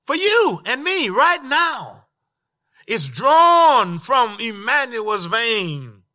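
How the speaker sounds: loud, high preaching with key words drawn out; full-force preaching. Real, not acted. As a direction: proclaim it to the congregation in big, swelling waves.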